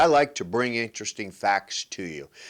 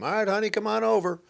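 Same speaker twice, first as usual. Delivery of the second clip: high, quick mock of a careless husband; hammed-up offhand invitation to his wife; lazy guy at halftime; 10 semitones higher, faster than usual. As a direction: toss it off between plays.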